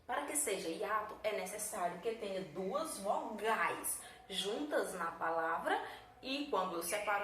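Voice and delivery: ordinary delivery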